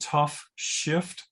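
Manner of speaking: slow, careful, words held apart; careful slow enunciation of a title; said slowly. Real, not acted. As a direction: say it slowly, separating the two words. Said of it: "You have to say it slowly".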